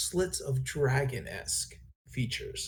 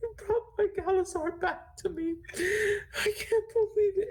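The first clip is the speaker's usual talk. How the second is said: very high, gasping, tearful squeal; acted crying in a high-pitched voice; sobbing barmaid. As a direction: sob it out between gasps, overcome with relief. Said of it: "And she is c-, sobbing".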